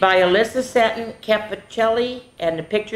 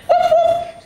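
high, loud joyful whoop; excited whoop; 8 dB louder than usual. Acted. Reading to kids, squealing with joy. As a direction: squeal it out with glee.

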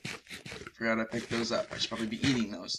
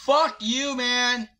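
shrieked curse, much higher and louder; high furious yell; 12 semitones higher, 9 dB louder, slower than usual. Real, not acted. Gamer cursing the mob that keeps attacking, furious high yell. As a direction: yell it in real rage.

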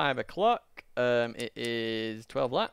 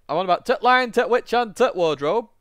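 high, loud northern English accent; hammed-up northern English voice; 8 semitones higher, 6 dB louder than usual. Shown as a demonstration. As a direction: lay on a broad northern accent, pitched well up. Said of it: "My northern accent".

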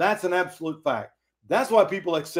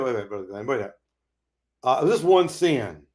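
ordinary delivery

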